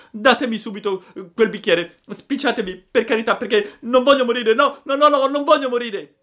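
very high, loud frantic pleading; panicked shrieking. A narrator's reading. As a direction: beg for your life in a child's squeal. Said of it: "cominciò allora a strillare il burattino". (supplica strillata, acutissima e disperata)

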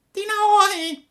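very high, slow mewling cat echo. A narrator's reading. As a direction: whine it like a stray cat. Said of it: "cat echoes fox".

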